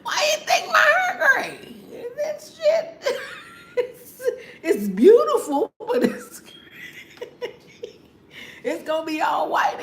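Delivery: very high laughing shout; laughing shout about going gray; shrieking with laughter. Real, not acted. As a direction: scream it out, cracking up.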